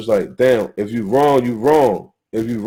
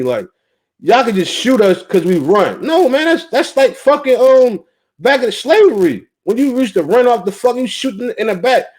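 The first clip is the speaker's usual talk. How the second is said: fed-up rant; 6 semitones higher than usual. Real, not acted. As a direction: let the outrage boil over. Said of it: "I'm fed up with the bullshit".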